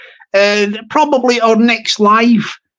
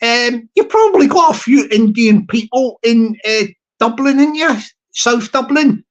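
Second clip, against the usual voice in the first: ordinary talk, nothing marked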